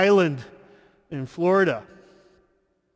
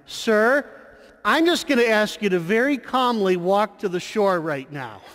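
loud, high, steady firm request; calm but assertive; 7 semitones higher than usual. Retold or imitated. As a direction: call out, polite but firm. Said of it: "but yet loudly and assertive".

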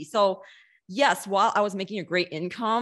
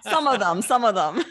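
laughing through the words, a bit louder; 4 semitones higher, 5 dB louder than usual. Real, not acted. Laughing as she answers the host's hopeful joke. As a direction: chuckle it out, amused.

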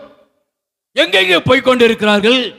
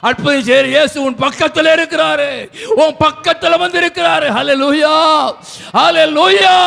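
climbing preaching cry ending in a high shout; rousing proclamation; 3 semitones higher than usual. Real, not acted. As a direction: build to a shouted hallelujah. Preaching climax into praise.

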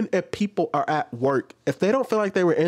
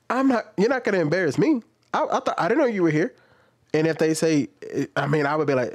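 ordinary talk, nothing marked

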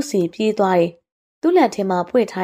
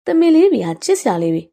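put-on higher, louder girlish gush; adoring delight; 5 semitones higher, 4 dB louder than usual. Girl seeing cute fawns, delighted. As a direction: coo excitedly over baby animals.

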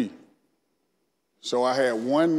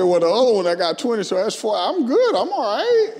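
higher, louder joking voice breaking into a laugh; joking through laughter; 9 semitones higher, 6 dB louder than usual. Retold or imitated. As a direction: joke about it, rising into laughter. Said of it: "and then I said".

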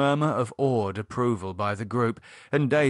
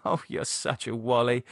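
amused laugh into the words; laugh, then tease lightly. A narrator's reading. Lydia teasing David for his principles, laughing. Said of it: "Lydia laughed".

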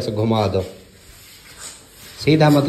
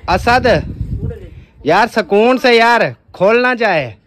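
calling out; 8 semitones higher than usual. Real, not acted. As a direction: shout across the room to slow him down. Calling Asad to be careful.

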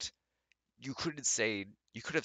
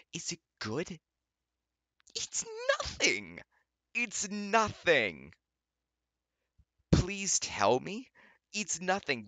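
hammed-up crying, much higher and slow; mock sobbing; 10 semitones higher, 3 dB louder, slower than usual. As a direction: wail it like a melodrama. Mock-dramatic sobbing exchange.

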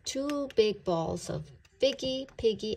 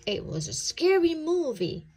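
shaken; 8 semitones higher, 5 dB louder than usual. A narrator's reading. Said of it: "Potts told his mom".

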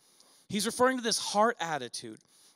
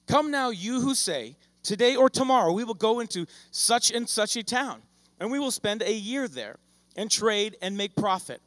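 scripture reading; 2 semitones higher, 3 dB louder than usual. Acted. Preacher reading scripture to his congregation, measured and deliberate. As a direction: read the verse out deliberately. Preacher reads James aloud.

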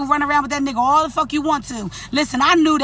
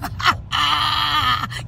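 long, drawn-out cackle; gloating laugh; 3 semitones higher than usual. Real, not acted. Wife relishing her own comeback, gloating laugh. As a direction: laugh it out after the punchline.

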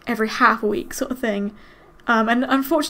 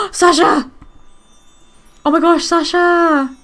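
loud, high cry of her dog's name; excited cry; 4 semitones higher, 6 dB louder than usual. Real, not acted. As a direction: call out to the dog, thrilled and alarmed. Cries out at her dog.

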